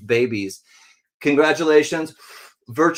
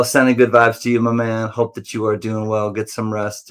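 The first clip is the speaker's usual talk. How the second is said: usual speaking voice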